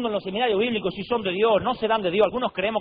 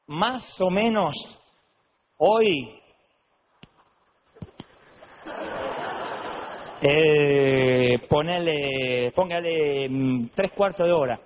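hesitant stalling; 5 semitones lower, much slower than usual. Retold or imitated. Pastor caught out, hesitating answer.

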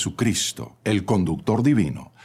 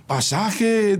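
high-pitched fare call; 11 semitones higher than usual. A narrator's reading. Fare collector to passenger hanging from door, calling out.

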